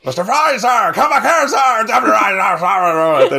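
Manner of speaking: high, loud mock sea-captain yell; hammed-up imitation of a bellowing officer; salty sailor shouting. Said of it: "it's incredible salty sailor talk".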